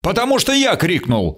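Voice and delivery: high-pitched, heated retort; narrated angry cry; furious shout. A narrator's reading. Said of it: "закричал бешено второй".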